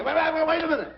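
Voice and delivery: high-pitched, urgent halting call; alarmed protest. Acted. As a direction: stop them before they jump in.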